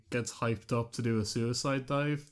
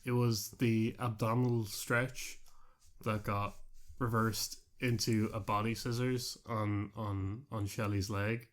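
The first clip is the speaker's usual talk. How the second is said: ordinary delivery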